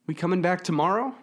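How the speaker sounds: high, loud call over roaring wind; shouting over wind. A narrator's reading. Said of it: "Lisa had to shout over".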